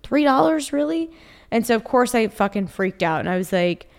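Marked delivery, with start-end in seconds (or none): 0.0-1.1 high, stunned "three dollars, really?"; pitched-up disbelief, replaying the moment; incredulous disbelief. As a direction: retell the shock of the tiny charge being declined. Card declined for three dollars.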